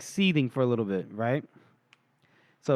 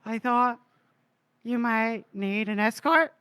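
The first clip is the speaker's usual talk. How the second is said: higher, slow, gallant offer; lightly voiced chivalrous rescuer; gallant hero line; 7 semitones higher, slower than usual. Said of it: "And he goes".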